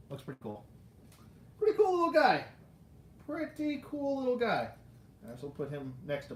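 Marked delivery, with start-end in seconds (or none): 1.6-2.5 high, louder playful coo dropping at the end; playful squeal. Pretend. Collector admiring a little figure, high playful gushing. Playful repeat about the toy. 3.3-4.7 playful. Pretend. Softer second take, same remark.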